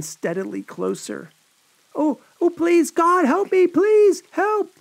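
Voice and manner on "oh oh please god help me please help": high, loud desperate cry for help; cried out. A narrator's reading. Annette begging God for help, terrified high cry. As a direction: scream for rescue in terror. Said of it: "Annette cried out".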